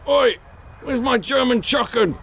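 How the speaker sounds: high-pitched mock outrage; comic, high indignant yell; indignant calling out. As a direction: call after someone walking off on you. [name] calls after departing Jens.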